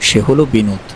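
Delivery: ordinary delivery, nothing marked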